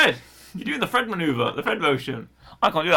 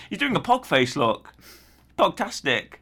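ordinary talk, nothing marked

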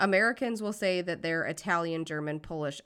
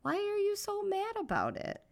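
mock indignant; 14 semitones higher than usual. Mock scolding partner.

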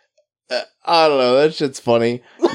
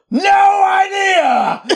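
very slow, high, loud yell; cheerful shout; 3 dB louder, much slower than usual. Pretend. Yelling driver answering the cop, slow shout. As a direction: belt it out slow and much higher. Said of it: "just yell".

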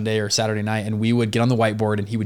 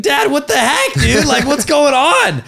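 very high and louder, mock outrage at dad; outraged disbelief; 9 semitones higher, 6 dB louder, slower than usual. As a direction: squeal it like a betrayed kid. Confronting dad about hidden money.

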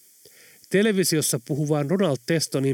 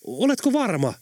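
higher-pitched puzzled question; wondering; 7 semitones higher than usual. A narrator's reading. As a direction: ask with mild wonder. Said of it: "ihmetteli Late". (lievästi ihmettelevä)

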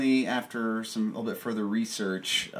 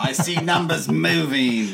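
louder, higher mock-Aussie voice; Australian accent; 4 semitones higher, 5 dB louder than usual. Host checks the recording is running, mock Australian accent. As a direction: call it out in a playful Australian accent. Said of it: "the Australian accents today".

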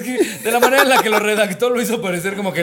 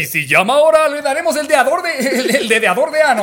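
loud, high pitchman voice; infomercial announcer; 7 semitones higher, 6 dB louder than usual. Pretend. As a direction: push the offer like a TV pitchman. Mock toy commercial offer.

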